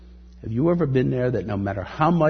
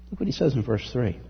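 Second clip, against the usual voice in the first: ordinary delivery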